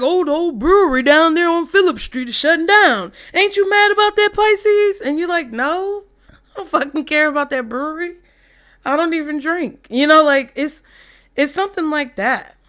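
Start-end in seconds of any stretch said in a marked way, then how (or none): 0.0-5.0 high, loud needling voice; busybody asking Pisces to be mad about the brewery, mock-indignant and loud; nagging busybody. Mocking someone pushing outrage. 5.5-9.9 mock indifferent reply. Voicing Pisces' dismissive reply.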